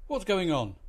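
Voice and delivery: loud angry roar. A narrator's reading. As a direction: bellow it from the bridge. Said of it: "roared the captain".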